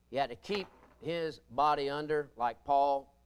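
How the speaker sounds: usual speaking voice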